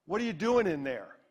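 retold demand from a stranger, understated; mild mock confrontation; mildly upset challenge. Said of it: "it sounded like he might be upset".

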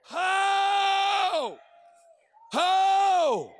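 high, sustained ringing shouts; soaring, drawn-out climactic holler; preacher's cry. Real, not acted. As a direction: call out the harvest with full fervour.

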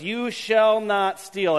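You shall not steal, each of the four words drawn out slowly; slow, deliberate recitation. Shown as a demonstration. Pastor announcing the day's commandment, slow and weighty. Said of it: "Four words".

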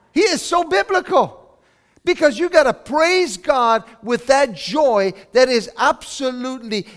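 high, loud, fervent preaching; preaching at full force. Acted. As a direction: lift the room with it.

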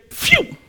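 high whistling whoosh; mock sound effect of a stone zipping by; whoosh of a thrown stone. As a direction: make the stone fly past with a sharp whistle. Stone whizzing toward the car.

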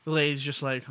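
ordinary talk

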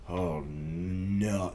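low, soft "oh no"; dismayed. Retold or imitated. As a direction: let it sink in quietly. Coming off stage after flop.

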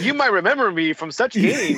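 lightly voiced Simpsons TV host; Troy McClure impression. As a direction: play a cheesy TV host. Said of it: "You're like in some Simpsons".

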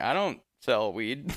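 slowly, each word drawn out; a deliberate, drawn-out denial; retold slow denial. To buyers who mistook him for the dealer, slow denial. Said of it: "And I'm like".

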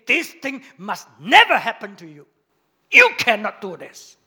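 forceful, loud scolding; loud rebuke. Retold or imitated. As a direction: rebuke him loudly and firmly. Peter rebuking Jesus, retold.

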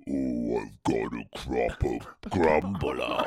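very low, slow dragged-out voice; like a tape played too slow; deep slowed-down mumble. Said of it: "You slow it down, all you can hear is".

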